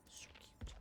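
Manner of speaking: barely audible whisper; whispered aside. Real, not acted. Streamer to themself while browsing, soft whisper. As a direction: whisper it under your breath.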